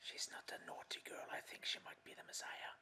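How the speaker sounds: hushed whisper to a friend. Acted. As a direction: breathe it quietly, no voice. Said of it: "I turn to Cal".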